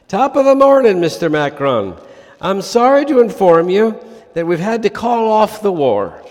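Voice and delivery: hammed-up Irish pub caller declaring war; attempted Irish accent. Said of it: "needs an Irish accent".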